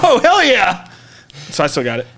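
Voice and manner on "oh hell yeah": high, gleeful exclamation; mock elation. Man finding his hidden sword, high gleeful cheer. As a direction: relive the triumph, pitch leaping up.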